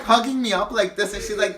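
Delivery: usual speaking voice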